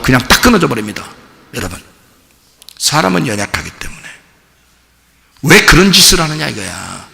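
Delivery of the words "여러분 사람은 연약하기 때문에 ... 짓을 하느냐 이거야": ordinary talk, nothing marked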